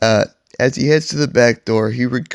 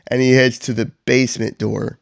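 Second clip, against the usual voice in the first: ordinary delivery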